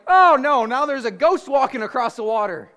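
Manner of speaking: loud, high-pitched cry of alarm; mock panic. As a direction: exclaim in hammed-up dread. Disciples panic at a ghost.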